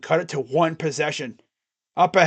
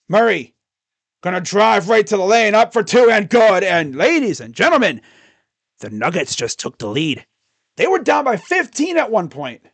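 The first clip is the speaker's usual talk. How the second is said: live basketball commentary, rising with each basket; excited broadcaster calling the comeback; play-by-play call; 3 semitones higher, 4 dB louder than usual. Acted. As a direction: call every possession like a TV announcer. Streamer calls the game live.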